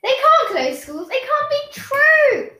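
high, loud screeched disbelief. Acted. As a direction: shriek it out in panic. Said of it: "screeched Ivy".

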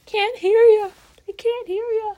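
high sing-song call. Real, not acted. Calling to muted friend on screen.

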